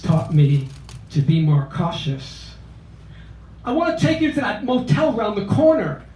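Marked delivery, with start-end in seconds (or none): 3.6-6.0 put-on fast South Philly accent. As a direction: pitch it like a South Philly guy. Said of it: "in his South Philly accent".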